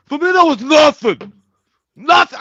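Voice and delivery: high loud mock bravado; shouting. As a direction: yell it like a boast. Exaggerated bravado outburst.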